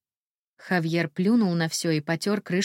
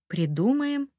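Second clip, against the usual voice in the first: surprised; 7 semitones higher than usual. A narrator's reading. Incredulous echo of his word.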